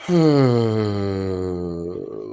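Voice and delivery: long, drawn-out falling sigh. Real, not acted. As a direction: let out one long sigh while thinking. Long exhale before looking over questions.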